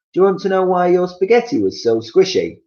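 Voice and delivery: high, loud teasing question; gloating Missus Twit. Acted. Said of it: "Then she said".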